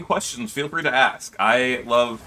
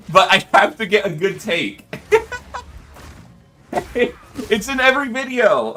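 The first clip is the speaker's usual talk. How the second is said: ordinary delivery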